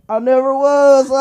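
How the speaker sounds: drawn-out smug high comeback; slow, high mock retort. Comic to haters, a slow high smug comeback.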